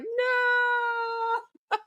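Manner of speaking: high drawn-out wailing no; high mock wail. Retelling Prince John's protest as a high wail.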